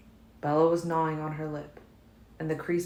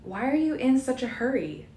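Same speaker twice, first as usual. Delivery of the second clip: a bit calmer but still high; slightly more level; 9 semitones higher, 4 dB louder than usual. A narrator's reading. Bella forcing calm after a sharp breath, still higher. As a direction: try to steady the voice. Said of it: "slightly more level tone".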